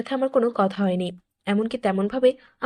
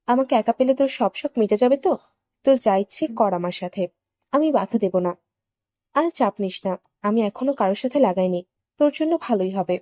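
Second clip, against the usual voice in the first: plain reading voice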